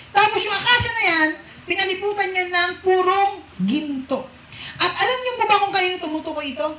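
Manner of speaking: loud, rising preacher's call; fiery preaching. Acted. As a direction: preach it out to the congregation at full force. Preaching builds. (malakas na pangangaral)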